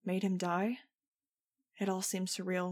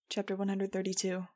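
usual speaking voice

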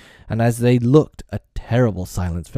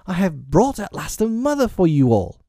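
high, proud announcement; excited cry; 10 semitones higher, 3 dB louder than usual. A narrator's reading. Peter presenting a mother to the boys, excited. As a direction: deliver glorious tidings high and eager. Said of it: "he cried".

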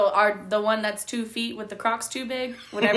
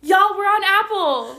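higher, louder, slow y'all; excited announcement; 10 semitones higher, 6 dB louder than usual. Real, not acted. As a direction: announce it with glee.

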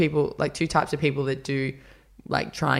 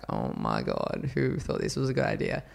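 weary disbelief; 6 semitones lower, 4 dB quieter than usual. Retold or imitated. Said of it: "I was like".

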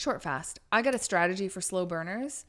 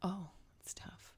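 whisper; 15 dB quieter than usual. Real, not acted. Muttered aside while deciding.

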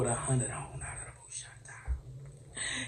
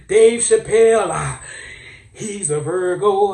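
loud, high mock-preacher roll call; 4 semitones higher, 10 dB louder than usual. Comic preacher naming famous Virgos, loud hammed-up proclamation. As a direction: shout the name like a sermon climax. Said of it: "let me get my preacher voice".

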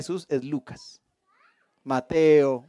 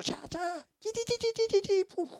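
high, soft cartoon combat sounds; mock fight noises; 14 semitones higher, 11 dB quieter than usual. Preacher mimics the Avatar mother killing, playful fight noises. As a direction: rattle off the fight noises like a movie.

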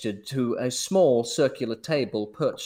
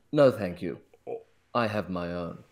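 blunt; 6 semitones lower than usual. Acted. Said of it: "replied with a blunt efficiency".

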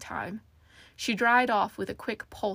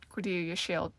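ordinary delivery, nothing marked

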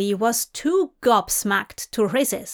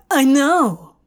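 overexcited; 4 semitones higher, 7 dB louder than usual. Acted. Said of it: "meant to sound suave ... overexcited child".

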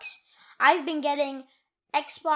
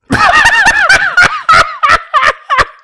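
laughing; 20 dB louder than usual. Real, not acted. Kid laughs at zombie request.

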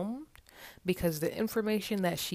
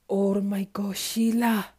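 higher and louder, thrilled to see her; excited greeting; 4 semitones higher, 7 dB louder than usual. Acted. Timothy spotting Sheila at her work, higher and louder. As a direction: burst out with delight.

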